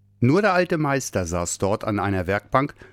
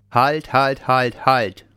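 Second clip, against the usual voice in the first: louder firm halt calls; interrupting; 3 semitones higher, 5 dB louder, much slower than usual. Acted. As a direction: cut him off firmly. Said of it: "unterbrach ihn der Nordpolarbär".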